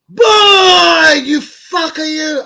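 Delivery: high, loud cry of pain; pained yell. Retold or imitated. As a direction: yell it as your eye burns. Retelling eye burning from chilli.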